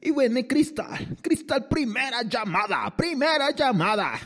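high, slow strip club emcee call. Acted. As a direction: announce the dancer like a club DJ. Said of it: "parezco como del table".

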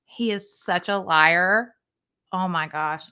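slow, low seething; real exasperation, held in and simmering; so mad. Real, not acted. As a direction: drag the words out low in disbelief. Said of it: "I'm so mad".

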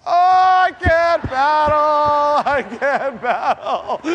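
slow, high, drawn-out pained wail; exhausted wail. Retold or imitated. Worn-out pastor whining he can't paddle, slow pained wail. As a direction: moan it out, arms like Jell-O.

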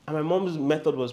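ordinary delivery

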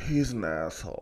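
lightly imitated stand-up line, pitched low; low mock verdict; Eddie Murphy bit. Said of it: "It was that Eddie Murphy Raw".

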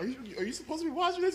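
fast, high scolding teacher. Retold or imitated. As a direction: rattle it off like a shocked teacher. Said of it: "He's like".

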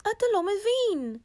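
high, hurt rabbit voice; a bit sad, a bit angry. A narrator's reading. Rabbit tells lion he doesn't understand, sad and cross. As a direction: say it high and wounded. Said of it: "אמר ארנב בקול קצת עצוב וקצת כועס".

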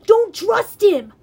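loud, high, drawn-out alarm; urgent warning. A narrator's reading. Grover warns hero against sparing monster, urgent cry. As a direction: cry out to warn a friend. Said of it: "Grover warned".

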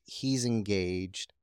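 normal talking voice

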